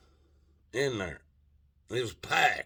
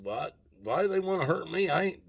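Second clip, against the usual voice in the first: much higher, rising disbelief; alarmed protest; 9 semitones higher, faster than usual. Retold or imitated. Narrator asking Slick why bar patrons want to hurt him, high alarmed protest. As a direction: squeak out the question in alarm.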